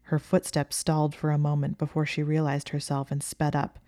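ordinary delivery, nothing marked

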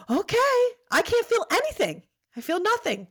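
giddy. Retold or imitated. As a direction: gush it like you're floating on drugs. High on epidural, giddy.

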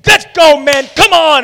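rally the horses in a huff; angry commander calling his men away; storming-off shout. Acted. Naaman storming off.

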